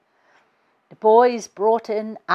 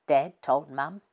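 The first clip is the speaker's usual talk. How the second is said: ordinary delivery, nothing marked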